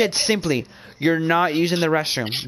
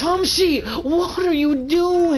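mocking high voice; 13 semitones higher, much slower than usual. Mock high voice at friend.

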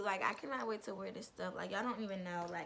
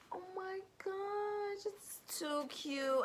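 delighted gasp; 10 semitones higher, much slower than usual. Real, not acted. Gasps over a gift.

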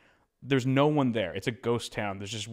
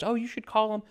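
put-on high old lady voice; sweet old neighbour, pitched way up; quirky old lady; 11 semitones higher than usual.

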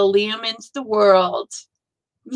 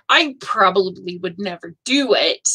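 usual speaking voice

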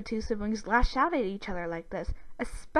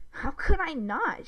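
slow, higher-pitched teasing; amused retort; 7 semitones higher, 4 dB louder than usual. A narrator's reading. Sister defending her prank, amused. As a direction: draw out a smug reply. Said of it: "she laughed again".

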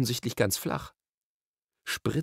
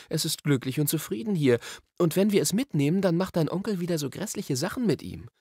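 Polly, concerned; 7 semitones higher than usual. A narrator's reading. Polly argues for leaving pet.

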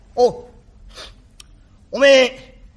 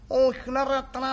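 ordinary talk, nothing marked

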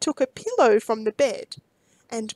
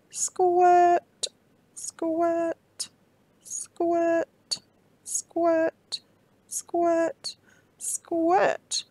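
squirting sounds; 4 semitones higher, much slower than usual. A narrator's reading. Milk squirting sound words.

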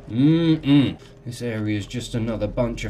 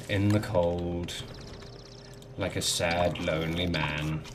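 self-pitying mumble; 7 semitones lower, 7 dB quieter, slower than usual. Real, not acted. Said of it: "This is my life now".

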